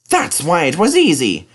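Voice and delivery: realising with a lift; James's voice. A narrator's reading. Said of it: "said James".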